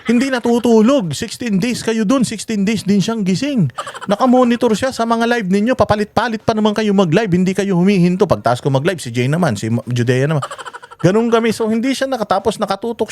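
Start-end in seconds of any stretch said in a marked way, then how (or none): 0.1-8.2 imitating a wife's raised-pitch complaint; mock scolding from his worried wife, pitched up; higher, slowed reproach from the wife. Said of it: "galit na galit sa amin".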